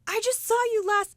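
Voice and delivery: imitating astonishment, very high and loud; amazed recognition. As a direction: blurt it out in high-pitched surprise. Retold startled recognition of her.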